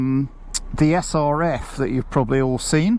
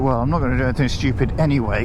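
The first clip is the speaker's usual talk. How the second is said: ordinary talk, nothing marked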